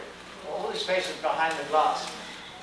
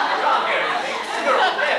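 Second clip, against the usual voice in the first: laughing joke; 10 semitones higher, 7 dB louder than usual. Real, not acted. Joking at the audience, laughing.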